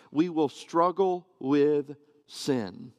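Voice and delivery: slow, weighted struggle with; stress on struggle with. Shown as a demonstration. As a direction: struggle with drawn out slowly. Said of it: "The emphasis in my mind is on the word struggle with".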